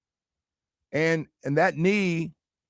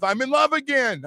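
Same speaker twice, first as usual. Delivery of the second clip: high, mock-dreamy voice of a smitten friend; mock lovestruck friend; 11 semitones higher than usual. Mocking his smitten cohost, high and sing-song. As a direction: coo it like a lovesick buddy. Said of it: "he might hit me with".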